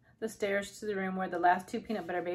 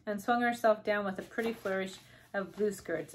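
usual speaking voice

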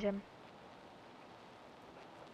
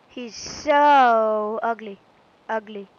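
disgusted; 7 dB louder, much slower than usual. Real, not acted. Disgusted at ugly Pokémon.

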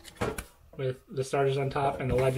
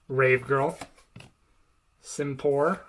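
usual speaking voice

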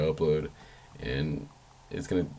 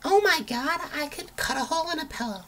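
much higher, squeaky "eureka" voice; squeaky excited. Retold or imitated. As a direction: squeal the idea like a kid who just found treasure. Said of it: "I was more like".

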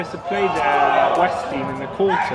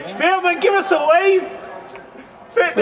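high loud call across the field; 12 semitones higher, 4 dB louder than usual. Real, not acted. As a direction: shout to get his attention. Calling to coach across pitch.

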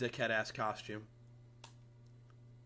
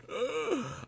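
mock gasp; 13 semitones higher, 4 dB louder than usual. Mock shock after kill.

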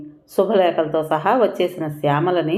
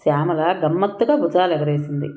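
ordinary delivery

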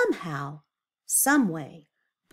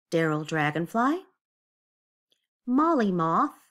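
ordinary delivery, nothing marked

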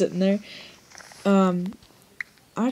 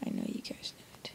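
hushed whispered repeat of the line; 18 dB quieter than usual. Pretend. Gamer whispering a teasing repeat to viewers. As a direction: breathe it as a secret to viewers.

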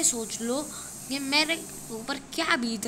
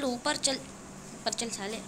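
ordinary talk, nothing marked

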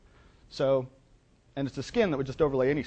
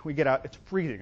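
usual speaking voice

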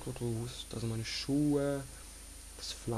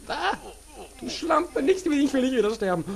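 panicked shouting; 12 semitones higher, 8 dB louder than usual. Real, not acted. Attacked, screams.

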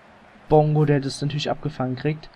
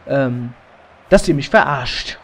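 ordinary delivery

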